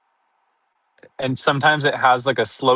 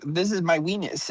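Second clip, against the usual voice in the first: lightly voiced kid, higher and softer; imitated schoolkid; 4 semitones higher, 6 dB quieter than usual. Schoolkids showing off elbow skin, lightly imitated kid voice. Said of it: "They'd all be like".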